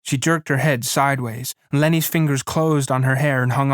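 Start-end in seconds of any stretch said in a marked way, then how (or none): none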